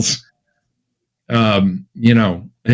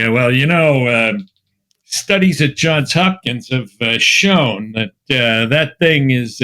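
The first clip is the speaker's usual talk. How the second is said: slow, drawling Boston know-it-all, lightly done; a mild Massachusetts mailman voice, hesitant; Boston accent; slower than usual. Retold or imitated. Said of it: "I have this accent down".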